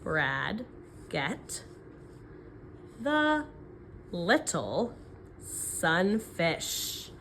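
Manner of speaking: word by word with pauses; counting the words. Shown as a demonstration. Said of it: "mark my six blanks".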